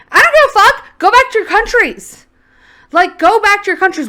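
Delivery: high, loud furious shout. Real, not acted. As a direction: yell it out in anger. Furious rant at the clip.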